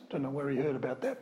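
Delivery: normal talking voice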